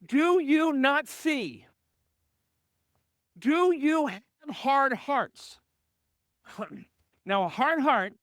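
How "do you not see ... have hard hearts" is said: slow, drawn-out rebuke; slow pointed question. Retold or imitated. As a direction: let each word sink in slowly. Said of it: "he asked them a question".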